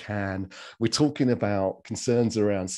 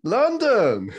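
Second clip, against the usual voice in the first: high, loud, laughing delight at the city's name; light up with real joy; delighted exclamation; 13 semitones higher, 9 dB louder than usual. Real, not acted. Greeting guest from London.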